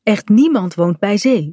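ordinary delivery, nothing marked

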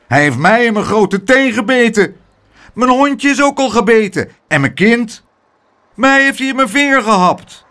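high, loud outraged complaints; clamorous shouting. A narrator's reading. Bitten neighbours complaining over each other, high outraged shouting. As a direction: shout as a string of indignant victims. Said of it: "door elkaar heen te schreeuwen".

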